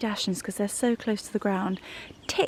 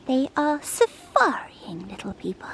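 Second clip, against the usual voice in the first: dog voice; 3 semitones higher than usual. Real, not acted. Owner talking about her dachshunds in mild dog voice. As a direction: coo about the dogs. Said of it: "my dog voice".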